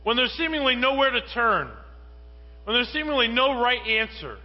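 fervent preacher building to a climax; building preaching. Acted. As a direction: climb with each clause.

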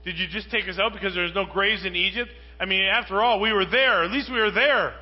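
angry crowd complaining to Moses; upset complaining. Retold or imitated. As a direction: gripe at the leader, self-pitying. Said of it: "all the children of Israel are upset with him".

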